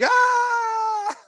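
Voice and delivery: sudden high pretend shriek of panic; mock scream. Imagining being chased across the yard, a high mock scream. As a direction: let out a loud, high, fake scream. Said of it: "And me screaming".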